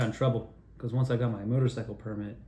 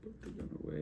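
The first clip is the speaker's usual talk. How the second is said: softer, pleading to put the gun away; calming dad; 4 semitones higher, 7 dB quieter than usual. Retold or imitated. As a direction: talk dad down gently. Son calming armed father.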